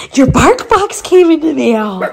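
high, loud, thrilled voice for the dog; excited pet talk. Real, not acted. Owner telling her dog its box arrived, high excited pet voice. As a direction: announce a treat to a puppy.

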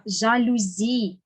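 жалюзі said slowly, stressed on final ЗІ; stress on last syllable. Shown as a demonstration. Teacher models correct жалюзі, slow, stressed on last syllable. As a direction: put the beat on the end of жалюзі. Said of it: "Маємо говорити так".

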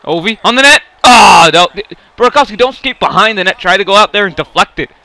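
frustrated yelling. Real, not acted. Yelling instructions at players.

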